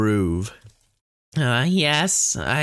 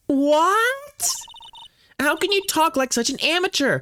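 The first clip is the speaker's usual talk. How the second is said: put-on high, incredulous girl; high, scolding then helpful; 13 semitones higher than usual. Voicing the assistant.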